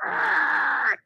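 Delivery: a short growled "Argh!" like a lobster with claws up; mock lobster growl. Babysitter showing a toddler a lobster noise, growled "Argh!". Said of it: "trying to teach her how to make a lobster noise".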